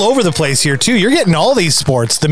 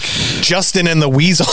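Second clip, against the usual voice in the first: ordinary talk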